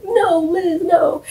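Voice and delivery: high, drawn-out mock wail; mock anguished plea. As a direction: plead in hammed-up horror. Acting out Kyle's horrified plea.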